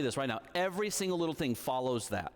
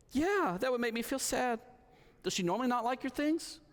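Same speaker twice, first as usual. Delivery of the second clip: put-on higher small-girl voice, scared; a child confessing fear at bedtime; little daughter; 10 semitones higher than usual.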